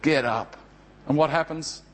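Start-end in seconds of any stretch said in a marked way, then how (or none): 0.0-0.6 gentle waking. Acted. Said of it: "like a parent waking a child".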